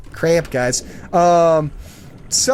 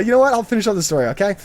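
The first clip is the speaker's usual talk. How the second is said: ordinary talk, nothing marked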